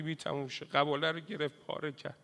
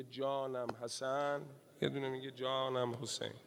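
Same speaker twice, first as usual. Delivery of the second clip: soft tender call; 4 semitones lower, 11 dB quieter than usual. Acted. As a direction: murmur it softly like a grieving mother. (زمزمهٔ آرام و کشیده)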